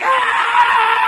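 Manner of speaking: mock shout. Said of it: "you're like".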